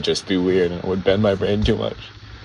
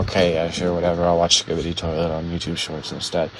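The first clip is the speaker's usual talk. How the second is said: bored, low, softer mumble; Jeremy tuning out; 5 semitones lower, 7 dB quieter than usual. Acted. Podcaster mimics a listener losing interest, tuned-out disinterest. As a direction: lose interest and let the voice sag. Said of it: "like, tuning out".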